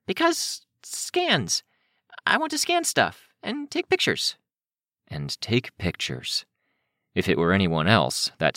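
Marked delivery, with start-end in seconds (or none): 0.1-3.3 put-on very high, halting girl voice; slowly fish for an excuse, pitched high; high-voiced, hesitant reasoning; Kenzie making excuses. 3.4-4.4 put-on very high girl voice; high-pitched young character; child's excuse. Child's excuse, then echoed by narrator.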